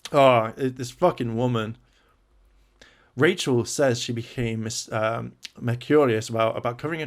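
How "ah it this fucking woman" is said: normal talking voice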